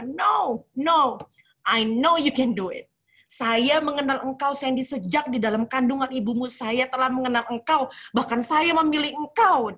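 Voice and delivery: God refusing her surrender; God tells Sandy she was chosen, firm and loud; firm divine answer. Retold or imitated.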